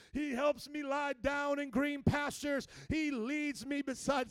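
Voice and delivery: slow, loud, emphatic proclamation; declaring in faith. Shown as a demonstration. Preacher showing the congregation how to pray, declaring boldly. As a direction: proclaim it firmly, stretching each word. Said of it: "declare some things in faith".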